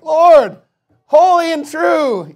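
high, loud, slow mock plea; mock impatient plea. Saints in heaven nagging God, hammed-up loud plea.

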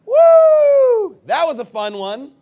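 sarcastic over-the-top celebration; mock glee. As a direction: ham up a giddy whoop of delight. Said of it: "that they're just like".

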